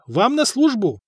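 put-on high-pitched insistent serving boy. A narrator's reading. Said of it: "настойчиво сказал паренёк".

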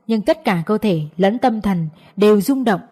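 plain reading voice

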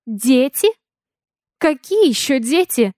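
startled, incredulous questions; shocked questioning. A narrator's reading. Heroine hears of children from pilot, stunned disbelief. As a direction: ask it as if stunned by the news.

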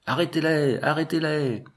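high-pitched cry of pursuers, twice; hurlements de poursuivants. A narrator's reading. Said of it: "qui hurlaient à leurs trousses". (cri aigu de foule à la poursuite)

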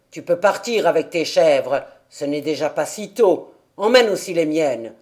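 put-on gruff old man. A narrator's reading. Old man sends Pierre off with the goats, gruff. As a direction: send the boy off like a grumpy hermit. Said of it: "cria-t-il à Pierre".

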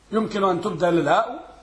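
ordinary delivery

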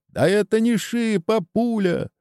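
high-pitched insistence; agitated protest. A narrator's reading. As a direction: insist on it, voice right up. Wounded man protests in panic. (взволнованное возражение)

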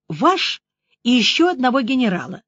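ordinary delivery, nothing marked

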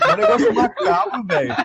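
high-pitched, laughing protest; laughing disbelief. Real, not acted. Friend baffled that others find it cute, laughing disbelief. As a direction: protest through helpless laughter.

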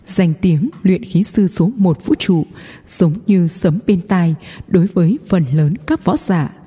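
ordinary delivery

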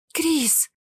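high, startled call, only mildly hushed; a touch of panic, pitched high; frightened half-whisper. A narrator's reading. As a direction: call out the name in sudden fright. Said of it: "испуганно прошептала Эвелина".

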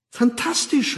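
high-pitched delighted cry; begeistert. A narrator's reading. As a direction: gush with enthusiasm. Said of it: "rief Fanny begeistert". (begeistert ausgerufen)

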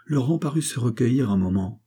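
ordinary delivery, nothing marked

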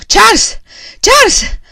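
high, loud terrified scream; screaming his name. A narrator's reading. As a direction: shriek for her husband in panic. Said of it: "Gridò". (grida il nome del marito, terrorizzata)

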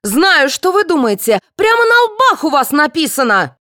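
high-pitched, accusing outburst; angry cry. A narrator's reading. Aida accusing everyone before storming off, crying out. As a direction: lash out at all of them. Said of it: "закричала Аида".

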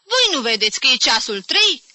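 loud, high scolding question; indignant outburst. A narrator's reading. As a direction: chide them for the late hour. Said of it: "izbucni deodată constatând ora".

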